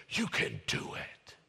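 hushed, breathy encouragement; a soft whispered pep talk; whispered God. Acted. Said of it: "And God says".

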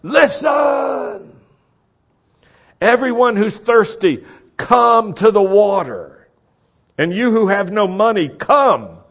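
high shouted summons; loud calling out. Acted. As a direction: cry it out to everyone within earshot. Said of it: "this loud calling out".

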